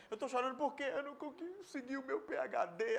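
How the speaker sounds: imitated whiny sobbing; mock crying. Preacher mimicking someone crying over a PhD, mock tearful. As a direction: put on a tearful complaint. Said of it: "O, o rapaz tá chorando".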